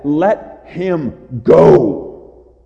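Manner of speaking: loud, drawn-out commanding shout; angry shout. Acted. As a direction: shout it slowly at an enemy, stretching the last word. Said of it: "he's shouting at death".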